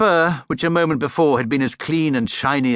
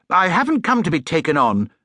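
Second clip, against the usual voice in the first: hot indignation; 7 semitones higher, 4 dB louder than usual. Acted. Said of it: "exclaimed Paddington hotly".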